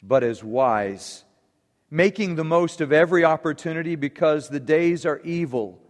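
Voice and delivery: measured, solemn public Bible reading; scripture reading. Acted. Reads scripture aloud to congregation.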